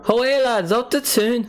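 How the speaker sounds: high-pitched, badly faked Geordie chant; Geordie accent attempt. Streamer tries out the accent for viewers, bad Geordie. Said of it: "Geordie accent, right, here it is".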